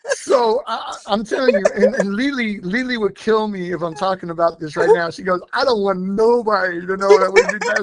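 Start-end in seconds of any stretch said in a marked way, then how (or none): 5.5-7.8 louder, higher quote breaking into laughter; laughing mock protest. Friend not wanting anybody to know about the song, laughing mock protest. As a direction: laugh through the imitated objection.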